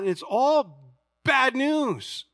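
high, loud, drawn-out mock wail; hammed-up exasperation; exasperated protest. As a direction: stretch it out in overdone dismay. Acting out his protest to professor.

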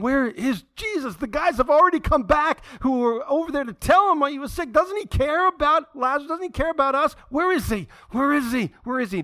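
high, insistent, agitated pleading; desperate questioning. Acted. Mary and Martha ask why Jesus stays away, desperate questioning. Sisters plead as brother worsens.

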